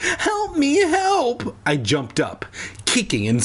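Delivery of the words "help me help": very high, hammed-up shriek; panicked cry for help. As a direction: squeal for help in mock panic. Narrated boy panics at creature.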